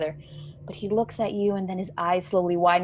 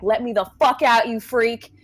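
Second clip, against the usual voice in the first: louder, urgent demand to escape; mock scream; 3 semitones higher, 5 dB louder than usual. Trapped boy shaking his captor, half-screamed. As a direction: hold back a full scream but push it out hard. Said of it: "he begins to scream".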